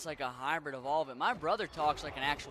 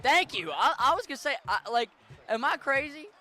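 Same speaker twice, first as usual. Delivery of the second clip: excited outburst; 9 semitones higher, 9 dB louder than usual. Real, not acted. Vindicated after chat agrees.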